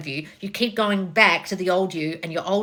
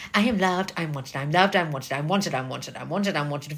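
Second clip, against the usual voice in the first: monotone chant, lower and softer; robotic affirming; 4 semitones lower, 4 dB quieter than usual. Acted. Coach mocking five minutes of affirmations, robotic. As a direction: rattle it off like a mindless mantra. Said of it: "this bullshit robotic affirming thing".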